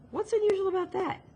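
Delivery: high-pitched defensive retort. Acted. Defensive reply in acted dialogue.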